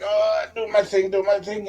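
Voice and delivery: retold pained effort. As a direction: play getting through it while hurting. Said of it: "in pain".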